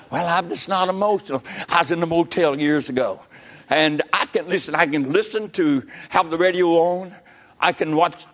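ordinary delivery

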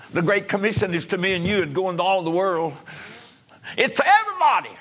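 high pulpit cry building to a shout; forceful preaching. Real, not acted. Preacher charges everyone with the Great Commission, building to a shout. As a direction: drive the commission home, ending at full volume.

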